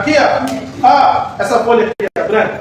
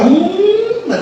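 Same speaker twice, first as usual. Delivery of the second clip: high, drawn-out "hum"; imitated hum; 9 semitones higher than usual. Preacher imitates how God gets attention, high humming. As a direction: hum upward as a signal from God. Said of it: "e Deus só faz assim".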